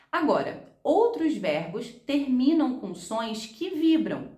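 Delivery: usual speaking voice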